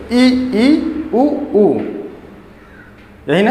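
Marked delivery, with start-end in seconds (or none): 0.1-1.7 isolated ई, ई, उ, उ with small gaps between; demonstrated vowels. Shown as a demonstration. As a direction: say ई and उ as separate, sustained vowel sounds. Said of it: "देखिए, बोल कर देखिए".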